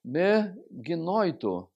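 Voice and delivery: slow Greek phrase; reading Greek aloud. Shown as a demonstration. Teacher reading Romans in Greek to students. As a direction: say Me gnoito slowly. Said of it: "como exemplo".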